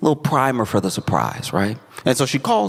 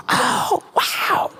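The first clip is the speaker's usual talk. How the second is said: high, airy mock amazement; mock surprise. Daughter seeing the surprise guest behind mom, mock amazement. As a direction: put on a squealed wow.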